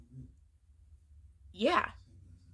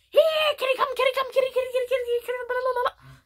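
high, loud sing-song calling of kitties; Bubbles' kitty call; 13 semitones higher, 5 dB louder, much faster than usual. Retold or imitated. Bubbles calling his lost kitties home, high and loud. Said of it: "that's his kitty call".

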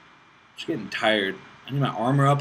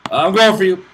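loud, higher-pitched call to attack; battle call; 6 semitones higher, 12 dB louder than usual. Real, not acted. Gamer announces an attack on an opponent, calling out loudly. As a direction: call it out loudly as you charge.

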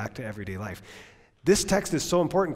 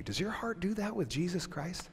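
normal talking voice

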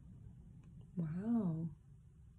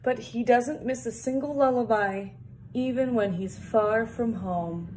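louder, lilting picture-book read-aloud; reading to children; 3 semitones higher, 6 dB louder than usual. Acted. Reading the picture book text to kids, storybook voice. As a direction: read it out warmly for little listeners.

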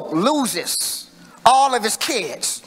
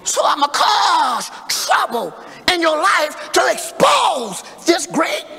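rising preacher's call; full-force preaching; 5 semitones higher than usual. Acted. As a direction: preach it up to the congregation. Preacher building to climax.

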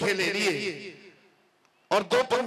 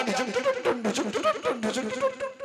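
breaking fan noise. Retold or imitated.

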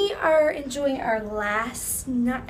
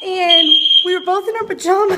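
fast, raised over the alarm; over fire alarm; 3 semitones higher, 6 dB louder, faster than usual. Real, not acted. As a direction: talk fast over the noise. Hotel fire alarm blaring.

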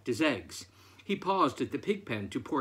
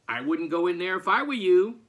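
quick, higher, louder duck voice; cautioning duck; 5 semitones higher, 7 dB louder, faster than usual. A narrator's reading. Duck warning farmer at the coop door, quick and high. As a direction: warn him off in a fast, raised voice.

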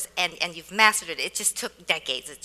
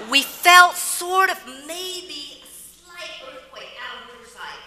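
slow, higher mock-tentative voice; mock tentative report; 7 semitones higher, 5 dB louder, slower than usual. Reporter mocking her tiny earthquake story, drawn out tentatively.

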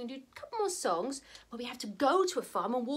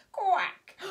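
higher, falling quack; duck quack; 5 semitones higher, 3 dB louder than usual. Acted. Showing children the duck's sound, quacking. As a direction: quack it high and short. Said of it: "he makes a sound like this".

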